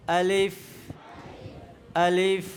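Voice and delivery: low, even আলিফ with a long hissed final f; correct আলিফ. Shown as a demonstration. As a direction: end আলিফ on a clear labiodental f. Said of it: "এইভাবে আমরা আলিফ উচ্চারণ করাবো".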